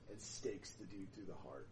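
usual speaking voice

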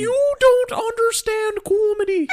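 put-on very high, slow old Irish woman voice with a wail; mock Jordan Peterson in an old Irish woman's voice. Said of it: "You sound like an old Irish woman".

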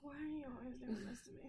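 laughing unvoiced whine; mock put-upon ghost; whiny spirit complaint. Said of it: "It's like".

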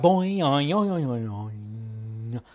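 drawn-out cartoon spring boing; bouncing boing that fades away; boing sound effect. Riffing on a silly nickname for viewers, a fading boing noise.

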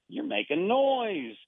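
normal talking voice

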